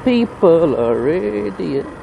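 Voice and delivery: slow, stretched-out syllables; drawn-out exasperation. Real, not acted. Rider lamenting other road users, slow exasperated drawl. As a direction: draw every word right out, exasperated.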